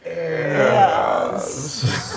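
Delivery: low, long drawn-out British yes; Sean Connery. Acted. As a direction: stretch it out low and slow, mock British. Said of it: "What are you doing, a Sean Connery?".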